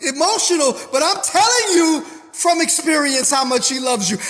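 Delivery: fervent, worked-up preaching; impassioned personal assurance to the congregation; emotional. Real, not acted. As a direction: preach it with feeling welling up. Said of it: "Excuse me getting so ups-, emotional".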